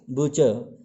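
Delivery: बुचर as "BUH-chuh", r left off; silent final r. Shown as a demonstration. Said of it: "वो रहेगा". (बुचर का अंतिम र बोले बिना)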